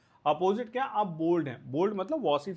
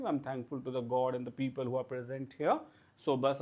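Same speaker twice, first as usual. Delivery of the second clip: calm humble thanks; 4 semitones lower, much slower than usual. Retold or imitated. Winner thanking the audience calmly and modestly. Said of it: "बड़ी शांति से सब smile करके".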